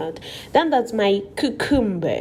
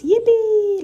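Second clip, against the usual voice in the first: high, loud yippee; much higher, louder triumphant yippee; excited cheer; 7 dB louder than usual. Real, not acted. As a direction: cheer it out with glee. Cheer of success.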